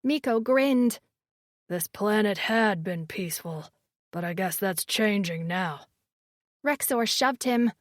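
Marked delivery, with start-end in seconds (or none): 1.7-5.8 deep male voice. A narrator's reading. Miko teasing, deep voice.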